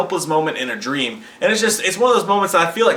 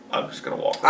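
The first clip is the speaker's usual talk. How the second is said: retold quiet retreat; 5 dB quieter, faster than usual. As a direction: imitate a soft, voiceless mumble. Retold dad backing out quietly.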